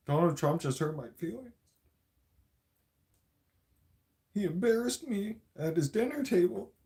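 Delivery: high, whiny put-on voice; whiny mock Trudeau. Trudeau complaining to Jolie, high and whiny. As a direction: whimper it like a hurt child. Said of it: "and said".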